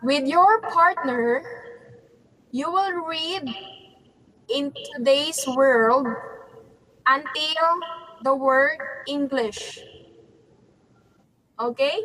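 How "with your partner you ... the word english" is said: slow, phrase-by-phrase instructions; speaking slowly. Real, not acted. Teacher repeating instructions for struggling learners, speaking slowly. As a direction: spell out the task slowly, pausing between phrases. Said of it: "I will speak slowly".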